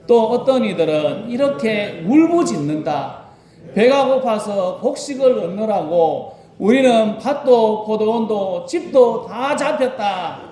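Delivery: slow, solemn Bible reading; scripture reading. Acted. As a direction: read the verse slowly and reverently. (천천히 성경 봉독)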